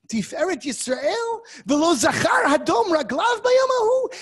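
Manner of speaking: a lecturer voicing the prophet's fury at God, shouting; indignant anger. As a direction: hurl the accusation at God, rising each time. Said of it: "he's reacting with indignant anger".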